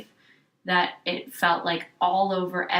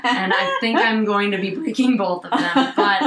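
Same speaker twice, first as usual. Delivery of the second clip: laughing confession, louder; half-laughing while owning up; 3 semitones higher, 8 dB louder than usual. Real, not acted. Laughing admission of breaking rules.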